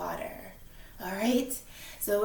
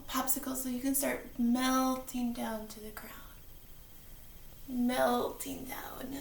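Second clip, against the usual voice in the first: whispering; 9 dB quieter than usual. Pretend. Whispering melting ice cream.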